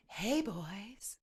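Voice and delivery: put-on airy breathy whisper of Hey, boys; Marilyn Monroe. Teacher imitating a breathy Marilyn Monroe greeting. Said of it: "That's like Marilyn Monroe".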